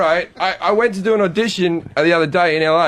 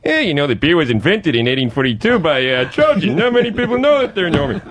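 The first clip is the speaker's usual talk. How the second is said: know-it-all barfly voice; Cliff Clavin. Acted. Comic showing his second impression, know-it-all bar regular. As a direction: rattle off trivia like a bar bore. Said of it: "Cliff Clavin".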